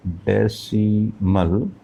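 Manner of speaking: Decimal drawn out very slowly, rising at the end; term stretched out. Shown as a demonstration. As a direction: stretch decimal syllable by syllable for the class. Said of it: "दशमलव यानी decimal".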